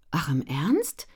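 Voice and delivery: breathy, surprised question; astonished. A narrator's reading. Ellen hears of the new print run, astonished. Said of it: "fragte Ellen erstaunt".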